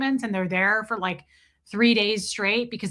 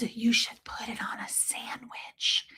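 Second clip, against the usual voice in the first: slow, breathy secret whisper; mom's whisper; 8 dB quieter, slower than usual. Retold or imitated. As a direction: whisper the tip slowly, like a secret. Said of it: "she does whisper sometimes".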